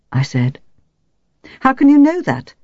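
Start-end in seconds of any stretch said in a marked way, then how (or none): 1.6-2.5 high-pitched, sharp challenge. A narrator's reading. Wife challenged on her cover story, sharp retort. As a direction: throw the question back sharply, pitch jumping up. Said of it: "Her voice was sharp".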